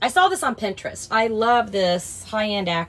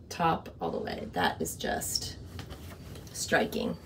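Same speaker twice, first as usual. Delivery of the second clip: admiring whisper; 13 dB quieter than usual. Real, not acted. Admiring the closet lighting.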